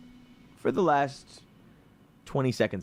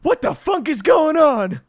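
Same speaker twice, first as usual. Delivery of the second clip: loud, high, shocked outburst; shrieking what the funk; stunned villain; 10 dB louder than usual. Acted. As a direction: yelp it like a frozen villain who can't believe it. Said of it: "he gives a, like".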